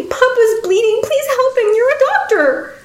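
put-on very high childlike wail; high-pitched, anguished plea; frantic pleading. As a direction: beg for help as a desperate little girl. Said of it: "Adelina pleaded frantically".

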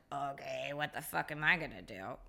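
low, hushed aside to oneself; resigned murmur, acted out; quiet inner panic. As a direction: mutter it low and softly under your breath. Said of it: "And I'm just like".

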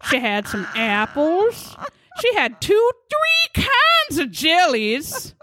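hammed-up teary testimony, drawn out; mock tearful witness.

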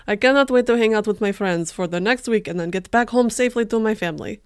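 loud, hammed-up Russian accent. Alexander writing about tomorrow's snowy mountain, Russian accent. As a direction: declaim the diary in a mock Russian accent. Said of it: "Should I do the accent too?".

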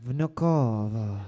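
low, slow Russian-accented Vnukovo; a bad Russian accent on Vnukovo; mock Russian accent. Shown as a demonstration. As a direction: say Vnukovo like a deep-voiced Russian. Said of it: "that was Spanish again".

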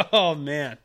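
bursting into a laugh; trying not to laugh. Real, not acted. Laughing at own superlative.

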